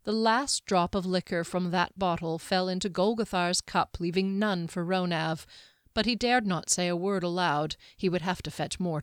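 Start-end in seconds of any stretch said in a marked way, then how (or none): none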